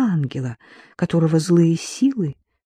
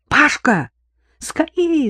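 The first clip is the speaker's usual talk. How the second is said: high, loud call down the hall; shout for help; 11 semitones higher, 3 dB louder, slower than usual. A narrator's reading. Daughter calling her brother to their mother, shouting. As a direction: yell for your brother urgently. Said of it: "закричала она".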